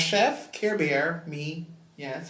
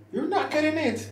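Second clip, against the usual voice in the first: much higher taunt; high taunting refusal; 9 semitones higher, 3 dB quieter than usual. Acted. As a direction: sing it up high.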